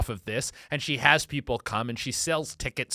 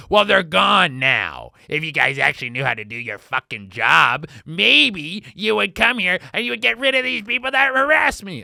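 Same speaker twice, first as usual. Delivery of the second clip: angry rant; 3 semitones higher, 6 dB louder than usual. Acted. Brenda scolding police who arrived late, loud angry rant. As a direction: tear into the cops for being late.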